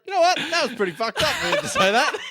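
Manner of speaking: hammed-up public complaint with a laugh; calling out. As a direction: turn and tell the whole shop loudly. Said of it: "be like".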